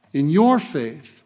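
"your" leaned on, slow, in "in your faith"; stress on your. Acted. Pastor stressing "your" to each listener, slow and weighty. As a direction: hit "your" so it feels personal. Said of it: "I emphasize the word "your".

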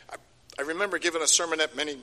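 ordinary talk, nothing marked

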